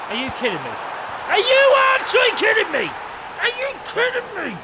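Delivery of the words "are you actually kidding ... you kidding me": much higher and louder, yelling in disbelief; outraged yell at the match; furious shout. Real, not acted. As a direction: scream it at the screen. Furious outburst at the game.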